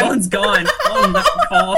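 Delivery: high, loud squeal through real laughter; laughing hard. Real, not acted. Guest notices the caller left, laughing hard. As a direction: barely get the words out for laughing.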